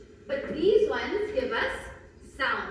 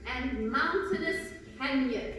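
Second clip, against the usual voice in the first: slow, drawn-out reading of the example; slower than usual. Shown as a demonstration. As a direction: read it slowly, letting the sounds hum. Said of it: "and that is".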